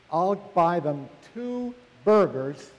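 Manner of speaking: normal talking voice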